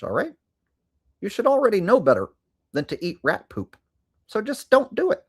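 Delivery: lightly voiced pundit lecture; a hint of a lecturing commentator; mock pundit. Ben Shapiro-style rant, repertoire.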